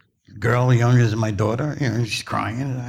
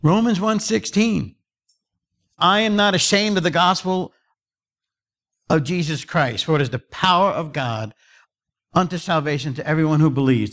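raised, declamatory pulpit voice; high, emphatic scripture recitation; preaching scripture; 5 semitones higher than usual. Real, not acted. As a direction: proclaim the verse to the congregation. Proclaims scripture to congregation.

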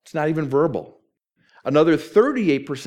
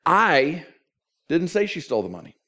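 I held apart, then the rest quicker; stress on I; 2 semitones higher than usual. Shown as a demonstration. Preacher showing how emphasis changes meaning, stress on I. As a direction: lean on "I", pause, then rattle off the rest. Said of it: "if I change the emphasis".

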